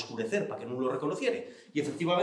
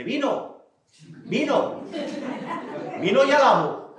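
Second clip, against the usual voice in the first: sastre impaciente; 8 semitones higher, slower than usual. Acted. Sastre pregunta ansioso por el vino.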